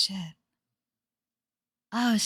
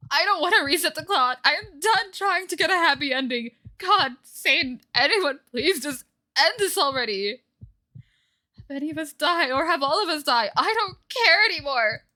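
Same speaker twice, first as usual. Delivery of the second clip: desperate pleading; 8 semitones higher, 4 dB louder than usual. Acted. Karma begging in despair.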